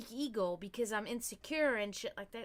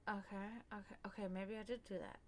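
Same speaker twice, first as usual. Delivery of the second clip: hushed, near-whispered admission; very soft, low self-talk; quiet self-reflection; 3 semitones lower, 14 dB quieter than usual. Acted. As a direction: concede it softly to yourself. Acts out private self-reflection.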